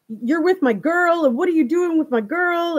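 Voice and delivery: put-on jealous boyfriend shouting at the guy; retold yelling. Trevor yelling at Christopher, jealous mock yell. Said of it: "he's yelling".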